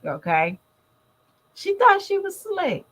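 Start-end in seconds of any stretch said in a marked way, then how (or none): 1.5-2.8 thought pitched way up in a teasing swoop; mocking sass. Real, not acted. Telling viewers about Jazz, high mocking sass. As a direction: tease her like she got caught.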